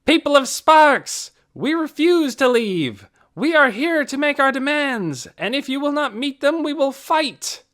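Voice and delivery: roared challenge. A narrator's reading. Tick roaring his demands at the people of Sparks. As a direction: throw down the challenge to the whole town. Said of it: "Tick roared out his challenge".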